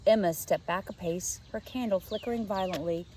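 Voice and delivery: usual speaking voice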